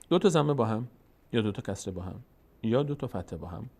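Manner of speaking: normal talking voice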